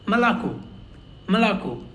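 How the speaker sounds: "Malako" with first vowels between A and O; reduced vowels. Shown as a demonstration. Teacher modelling for learners, "Malako" with reduced vowels. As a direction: keep early vowels of "Malako" light, final O full. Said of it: "in between A and O".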